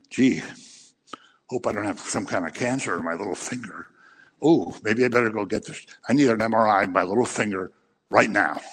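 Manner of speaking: a hint of a worried hypochondriac musing to himself; mock hypochondriac. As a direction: play a fussy man fretting over his finger. Mocking self-absorbed hypochondriac.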